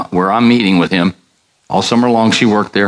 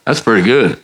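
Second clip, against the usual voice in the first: ordinary delivery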